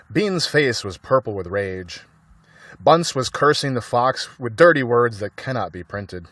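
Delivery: ordinary delivery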